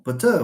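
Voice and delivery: "butter" said but-TER, second syllable strong; misplaced final stress. Shown as a demonstration. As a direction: wrongly stress the end of "butter". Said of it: "We don't speak like this".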